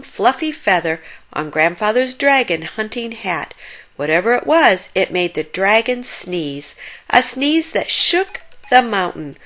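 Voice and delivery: usual speaking voice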